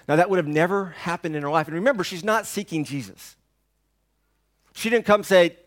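normal talking voice

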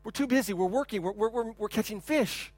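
half-voiced, stuttering refusal; busy fishermen. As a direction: play reluctant fishermen brushing someone off. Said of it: "the fishermen looked at him and go".

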